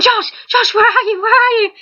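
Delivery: high, frantic cry for Josh; panicked calling out. Retold or imitated. Waking from a nightmare, calling for Josh in panic. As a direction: call out for him in a panic, near tears. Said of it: "I woke up screaming for Josh".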